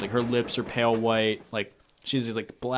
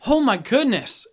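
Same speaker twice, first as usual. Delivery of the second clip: high, louder shocked exclamation; alarmed stewardess; 11 semitones higher, 6 dB louder than usual. Acted. Stewardess finding a collapsed passenger, alarmed. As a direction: exclaim as a startled train stewardess. Stewardess finds the slumped woman.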